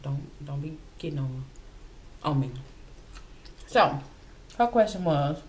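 talking while chewing; eating between words; mouth full of chicken. Real, not acted. Seen 4: bites chicken at 1.5, cheek full and chewing through 5.2.

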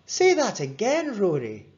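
put-on high-pitched boy asking up close; insistent friend. A narrator's reading. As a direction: urge your pal to repeat it. Friend leans in, asks repeat.

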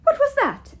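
high, loud startled question; startled demand. A narrator's reading. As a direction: demand it sharply, pitch leaping up. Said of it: "the giantess demanded".

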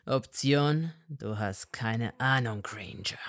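low, half-whispered scorn; scornful. A narrator's reading. Malfoy mocking Hermine, low scornful. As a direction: sneer it down at her.

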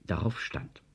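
ordinary delivery, nothing marked

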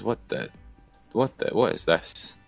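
ordinary talk

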